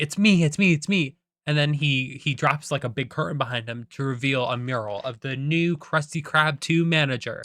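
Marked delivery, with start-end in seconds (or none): 0.0-1.2 higher, louder, eager cartoon chant; SpongeBob. Hopeful employee sure he has won, raised eager chant. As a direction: squeal it eagerly as the sure winner. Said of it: "SpongeBob is like".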